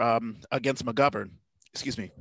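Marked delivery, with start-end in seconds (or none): 1.7-2.1 soft, aside apology; quiet embarrassed excuse me; excusing herself. Real, not acted. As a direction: mutter an excuse me off mic. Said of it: "I have to edit that out".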